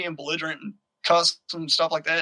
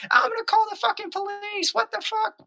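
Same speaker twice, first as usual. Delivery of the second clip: shrieky high imitation of a screaming wife; high-pitched retold scream; mock screaming. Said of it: "his wife is on the front porch screaming".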